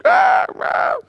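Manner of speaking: high unvoiced imitated sob; breathy high child's sob; mock sob. Imitating son's sobbing.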